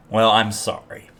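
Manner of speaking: ordinary delivery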